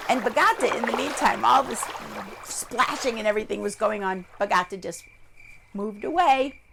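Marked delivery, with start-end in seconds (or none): none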